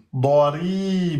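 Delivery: ordinary delivery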